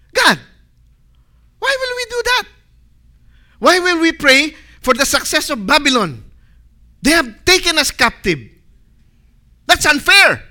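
high, loud indignant complaint; protesting to God. Acted. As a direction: argue with God like an aggrieved captive. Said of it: "how we debate with God".

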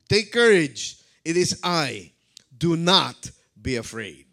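loud, firm acted proclamation; commanding call. Preacher voices Jesus to terrified disciples, loud and commanding. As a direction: call it out strongly, falling to the end.

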